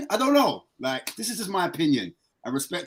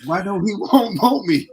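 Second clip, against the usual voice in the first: higher and louder, whiny plea; mock hurt child; 7 semitones higher, 7 dB louder than usual. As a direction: ask like a kid abandoned by his dad. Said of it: "I got upset".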